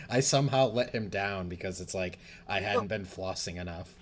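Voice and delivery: usual speaking voice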